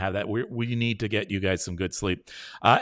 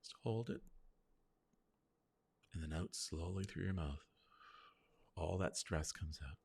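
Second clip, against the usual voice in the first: much softer, calm breathing cue; softly leading a breath exercise; guided breathing; 5 semitones lower, 9 dB quieter than usual. Shown as a demonstration. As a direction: ease them through the breath. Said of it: "let's breathe in through our nose".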